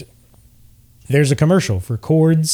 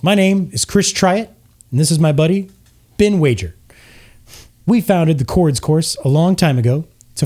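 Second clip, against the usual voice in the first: higher, overexcited commercial pitch; cheesy half-elf ad; 5 semitones higher than usual. Acted. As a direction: sell it like a pushy TV ad host. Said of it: "And the half-elf says".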